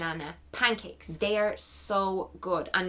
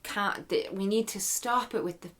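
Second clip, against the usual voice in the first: ordinary talk, nothing marked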